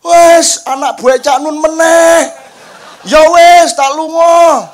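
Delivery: high, loud, laughing voice of a possessed man; possessed man's voice. Retold or imitated. Spirit speaking through possessed person, high loud and laughing. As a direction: shout it high as the spirit giving up. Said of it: "Terus dia bilang".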